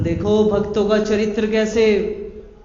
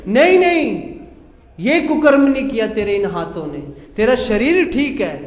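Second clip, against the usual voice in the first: divine correction; 3 semitones higher, 5 dB louder than usual. Acted. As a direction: correct him firmly, voice raised. Jagannath corrects Sadna. (भगवान का ज़ोरदार, ऊँचा खंडन)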